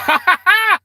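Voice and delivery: bursting into a high, loud laugh; a real burst of laughter. Real, not acted. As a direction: cracks up loudly. Laughs at viewer's joke.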